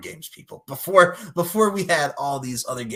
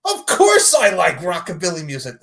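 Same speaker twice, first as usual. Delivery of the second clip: mock eager reply; 4 semitones higher, 8 dB louder than usual. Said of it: "you go like".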